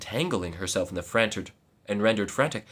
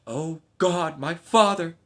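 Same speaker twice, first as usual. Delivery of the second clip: anguished high cry; 8 semitones higher, 6 dB louder, slower than usual. Acted. Daughter finding her slain father, high anguished cry. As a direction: cry out high and loud in grief. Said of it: "exclaimed Erilda".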